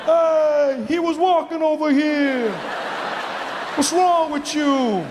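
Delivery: high, slow, stretched New Yorker yelling; New York accent shout. Retold or imitated. Comic mocking a New Yorker yelling at a taxi, in a high stretched shout. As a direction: ham up a brash New Yorker yelling at traffic. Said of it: "it's like".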